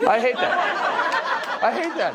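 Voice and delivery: high, slow, laughing "I hate that"; laughing disgust. Acted. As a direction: stretch it out through a laugh. Mocking fake-nice Americans.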